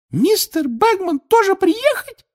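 very high, slow elf question; high-pitched, startled house-elf; squeaking Winky. A narrator's reading. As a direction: squeak it loudly like a tiny elf. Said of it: "пискнула Винки".

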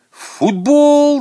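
high, loud drawn-out cry; excited shout. A narrator's reading. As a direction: shout it out like an eager schoolboy. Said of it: "закричал Саня".

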